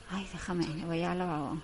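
usual speaking voice

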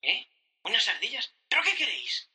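high, loud startled cry; shocked. Acted. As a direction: react in disbelief to squirrels. Worker meets talking squirrels.

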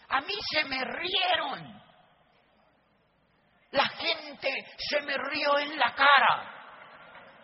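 a touch of a plaintive complaint, slow; mimicking the distributors. Speaker quotes the distributors on the CDs, mildly plaintive. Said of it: "me decían esos C Ds".

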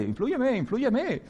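high, eager "influence me" plea; mock plea. A student begging the world: influence me, high playful plea.

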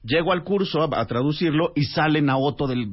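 usual speaking voice

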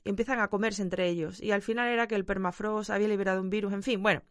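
normal talking voice

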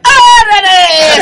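long high shouted órale; drawn-out grito. Acted. Musician greets the audience with a long shout. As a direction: let out a mariachi yell.

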